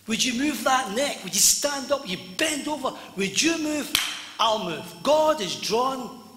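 loud, high, urgent pulpit delivery; full-force preaching. Acted. Preacher voicing God's call to the congregation to move, full-force preaching. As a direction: preach it out at full volume, pitch climbing.

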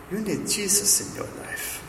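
high, soft, put-on German accent; Margot's German accent. German woman witnessing to her neighbour, high mock German accent. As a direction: imitate an elderly German woman's strong accent. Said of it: "every time I do Margot's accent".

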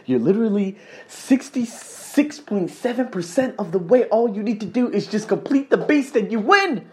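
high-pitched coaxing conscience; little shoulder angel. Acted. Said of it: "little angel on my shoulder was like".

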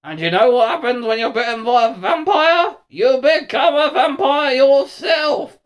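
loud, high, menacing snarl, drawing out the threats; vampire snarl. A narrator's reading. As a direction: snarl it through vampire teeth, building to a shout. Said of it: "he snarled between his vampire teeth".

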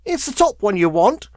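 high crone's snarl. A narrator's reading. Woman pointing the lawyer to the right letter, snarling. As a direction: snap it out sourly in a crone voice. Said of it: "she snarled".

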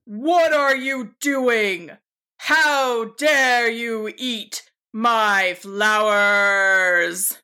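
slow, drawn-out wizard's outrage; angry booming voice. A narrator's reading. Wizard catching a sheep eating his flowers, angry and booming. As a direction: boom it out slowly, stretching the words. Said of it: "angry, booming voice".

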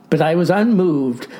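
ordinary delivery, nothing marked

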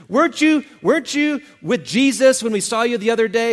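high, loud, insistent challenge; loud bystander demanding an admission; accusing question. Retold or imitated. Third accuser questioning Peter.

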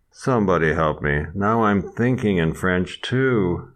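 normal talking voice

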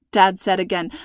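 ordinary delivery, nothing marked

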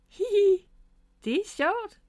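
high-pitched, tickled giggle into the words; giggling. A narrator's reading. Miffy floats like a balloon above Bob, giggling. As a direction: giggle with delight, high and light. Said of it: "grinede Miffy".

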